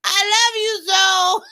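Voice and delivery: over-the-top Sherelle impression, shouted and teary; imitating Sherelle. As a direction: wail it out as a hammed-up Sherelle. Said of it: "That's as close as I can get to her voice".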